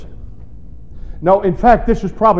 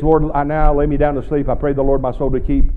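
put-on low, fast, sing-song child's prayer; rote bedtime prayer; 4 semitones lower, faster than usual. Pastor mocking a rote bedtime prayer, rushed. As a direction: rattle it off like a bedtime rhyme. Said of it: "they didn't pray this".

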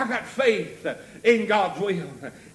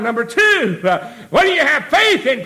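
raised, loud sermon call; preaching shout; 6 semitones higher, 7 dB louder than usual. Real, not acted. Preacher announcing his second point, shouted preaching. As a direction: call out the next point loudly.

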